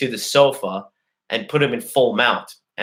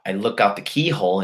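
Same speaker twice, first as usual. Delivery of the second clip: ordinary delivery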